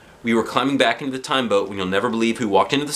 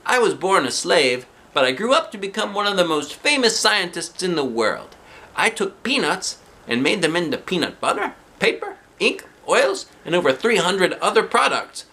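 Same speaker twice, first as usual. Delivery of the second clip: lightly higher storybook voice; famous scientist; 10 semitones higher than usual. Acted. George Washington Carver telling the kids his story. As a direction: read it like a proud historical figure.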